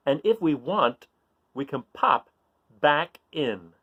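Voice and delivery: put-on slow, sing-song riddle reading for kids; reading riddle aloud. Reading a riddle to young listeners, slow and playful. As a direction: read it slowly to the children, savouring each clue. Reads tortoise riddle to children.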